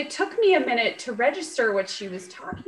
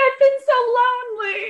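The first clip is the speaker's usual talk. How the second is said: much higher, louder mock wail; mock lonely; 6 dB louder than usual. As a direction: ham up the lonely sob. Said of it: "I was like".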